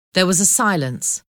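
plain reading voice

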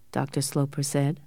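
plain reading voice

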